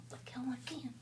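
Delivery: defiant whispered comeback. Retold or imitated. Mom whispering back at her husband in the car. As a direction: hiss back under your breath.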